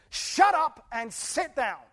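stern command. Retold or imitated. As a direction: order the storm to stop. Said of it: "he says".

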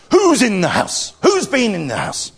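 loud, high, acted roar; Father Bear's roar. Father Bear finds an intruder, roaring. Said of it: "went in and roared and said".

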